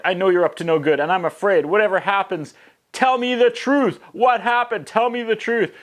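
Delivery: high, frightened pleading that climbs; hammed-up fearful sister; mock pleading. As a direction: beg for the truth in a high voice.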